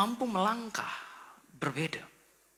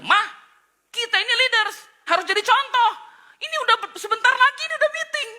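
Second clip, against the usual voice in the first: high, loud comic scolding of the wife; mock scolding; 7 dB louder than usual. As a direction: nag her like a flustered husband. Husband scolding wife in daster. (suami memarahi istri, lucu)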